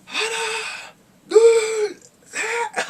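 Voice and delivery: very high, loud exertion cries; mock strongman yells; imitated lifting shout. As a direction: shout each word as if heaving a weight. Acting out man lifting heavy stack.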